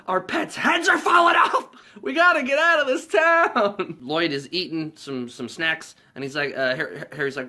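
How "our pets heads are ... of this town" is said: much higher, loud, laughing through the shout; laughing rant. Retold or imitated. Harry ranting to Lloyd, shouting through laughter. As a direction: yell it while cracking up.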